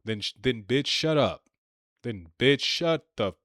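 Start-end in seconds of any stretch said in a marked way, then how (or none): none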